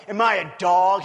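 loud, roaring challenge read aloud; ryk Goliata. A narrator's reading. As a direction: read it as a giant's roar, loudly. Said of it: "he roared at David".

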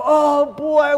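the lovestruck admirer; mock delight. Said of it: "We go".

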